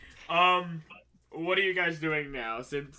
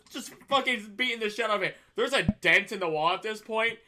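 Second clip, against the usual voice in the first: usual speaking voice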